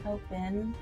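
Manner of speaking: usual speaking voice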